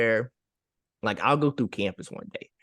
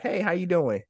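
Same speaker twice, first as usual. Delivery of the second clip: casual passing greeting; 8 semitones higher than usual. Retold or imitated. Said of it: "you'll be like".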